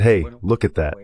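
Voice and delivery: ordinary talk, nothing marked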